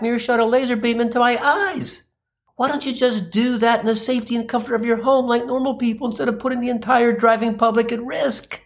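high-pitched, mounting exasperated rant; snapping tirade. Acted. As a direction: launch into an acted rant, pitch climbing and staying up. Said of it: "I snap and launch into a tirade".

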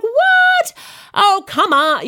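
incredulous American. Retold or imitated. American friend disbelieving her job, mock American accent. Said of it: "my accent's terrible".

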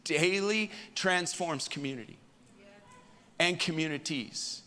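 high, loud, slow preaching cadence; preaching at full force. Acted. Pastor preaching to his congregation, loud and slow. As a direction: pour it out like a revival sermon, slow and ringing.

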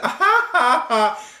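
high, hammed-up fake cackle; mock her silly high laugh; imitating a goofy wrestler's laugh; stupid laugh. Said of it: "she started doing this stupid laugh".

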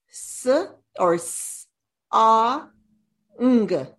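s, ah, ng sounded out separately; sounding out words. Shown as a demonstration. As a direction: sound out song sound by sound, ng as one sound. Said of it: "to sound out the word song".

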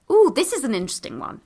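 ordinary talk, nothing marked

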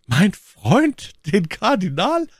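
high, startled-sounding question, rising sharply; exclamation. A narrator's reading. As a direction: cry it out as a disbelieving question. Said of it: "rief my Lady". (erstaunter, hoher Ausruf)

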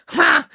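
short, high, loud "ha"; scornful snort. A narrator's reading. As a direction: snort a burst of derision. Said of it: "April snorted".

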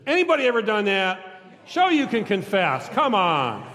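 usual speaking voice

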